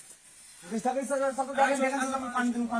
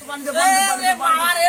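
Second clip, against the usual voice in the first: frantic shout; 11 semitones higher, 11 dB louder than usual. Acted. Frantic call for water.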